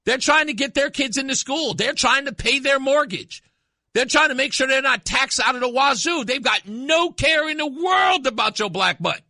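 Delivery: fired-up shout at the people hollering about racism; retold rant. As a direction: hammer it home like a street preacher. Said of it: "I said".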